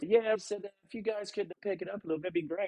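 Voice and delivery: put-on high, soft Australian accent. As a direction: politely ask them to hurry, Aussie style. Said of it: "Dean, my head pro, he's".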